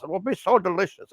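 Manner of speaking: lightly acted monster gloating; imitated movie threat. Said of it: "where he's like".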